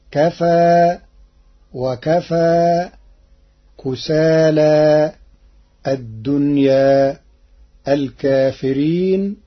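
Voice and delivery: each word drawn out slowly, its final alif bent halfway toward ē; taqlīl demo. Shown as a demonstration. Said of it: "قرأها القارئ بالتقليل".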